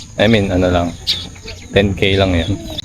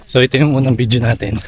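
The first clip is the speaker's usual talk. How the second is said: ordinary talk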